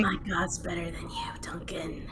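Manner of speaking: teasing whisper to Duncan. Acted. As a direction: hiss it playfully under your breath.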